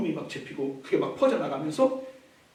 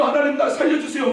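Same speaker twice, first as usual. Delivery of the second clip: tearful plea; 7 semitones higher, 4 dB louder than usual. Acted. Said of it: "눈물을 흘리며 통회하며".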